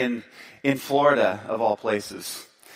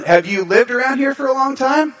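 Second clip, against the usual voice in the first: higher, louder eager question; 8 semitones higher, 5 dB louder than usual. Retold or imitated. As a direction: keep trying to chat. Speaker pressing for conversation.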